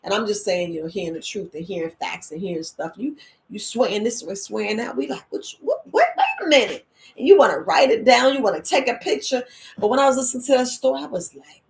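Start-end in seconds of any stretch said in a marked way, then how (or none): 5.3-6.8 much higher confused stammer; flustered surprise. Retold or imitated. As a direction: sputter like caught off guard. Confused listeners reacting.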